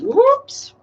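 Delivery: startled little yelp as the cards spill; surprised. Real, not acted. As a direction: caught off guard, high whoops. Cards fall on the table.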